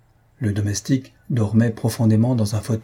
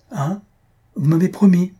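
high-pitched, rising to louder reminder; mild reproach; 9 semitones higher, 4 dB louder than usual. A narrator's reading. Nurse checking a patient who tries to talk, mild reproach.

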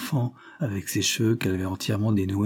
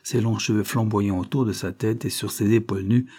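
ordinary delivery

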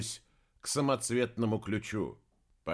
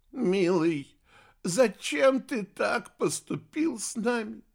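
plain reading voice

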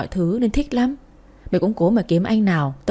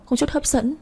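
hurt, tearful; 6 semitones higher than usual. Acted. Heartbroken friend repeats what her ex said, tearful and higher. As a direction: voice jumps higher on the insult, wounded.

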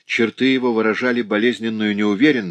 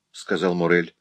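ordinary delivery, nothing marked

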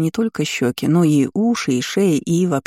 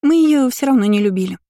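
high-pitched twin's chime-in; girlish reassurance; 7 semitones higher than usual. A narrator's reading. Lina adding comfort for the mage, high chirp. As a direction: pipe up high to back your sister.

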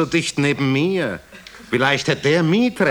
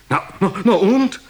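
eager prodding; 10 semitones higher, 4 dB louder than usual. Acted. Listener eager for juicy details.

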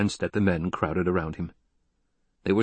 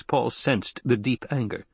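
ordinary delivery, nothing marked

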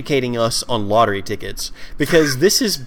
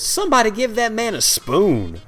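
put-on old woman voice; 7 semitones higher than usual.

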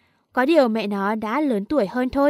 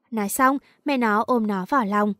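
usual speaking voice

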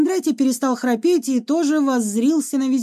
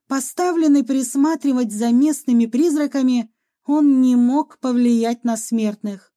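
ordinary delivery

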